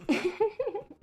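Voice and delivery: very high giggle; high, amused little laugh. Real, not acted. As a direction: giggle away in a high pitch. Amused by the odd Filipino spelling.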